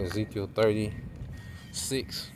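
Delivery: drawn out, each syllable spelled slowly; slow, deliberate chapter call; slow announcement. Real, not acted.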